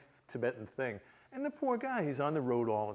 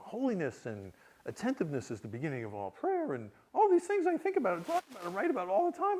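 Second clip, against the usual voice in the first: ordinary talk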